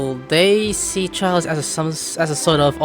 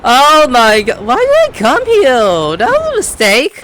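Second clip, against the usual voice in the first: loud wail that climbs higher; mock-wailing regret; 7 semitones higher, 6 dB louder than usual. Real, not acted. Gamer bemoaning coming to Fontaine, wailing. Streamer groans over arriving somewhere.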